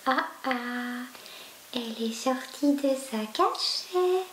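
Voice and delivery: gently voiced for the cat; light cooing voice; light little voice. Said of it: "quand je prends une petite voix".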